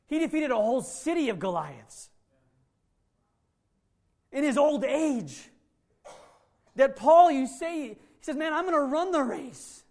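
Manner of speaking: full-force sermon delivery, high and loud; impassioned preaching. Real, not acted. Pastor urging the congregation toward their upward call, impassioned preaching.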